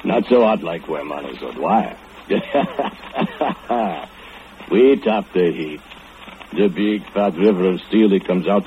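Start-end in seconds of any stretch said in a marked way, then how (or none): 2.3-4.0 dark chuckle. Acted. Laughs at Manus's death.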